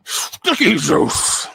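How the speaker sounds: very slow, high horse voice; put-on horse character, stretched words; talking horse. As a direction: draw it out as a hammed-up horse. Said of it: "our special horse, says".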